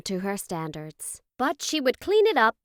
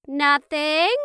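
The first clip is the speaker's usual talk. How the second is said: put-on high, louder kids' chorus; kids in unison; 7 semitones higher, 6 dB louder than usual. A narrator's reading. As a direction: answer brightly like two guilty children. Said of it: "The unified voice instantly sent chills".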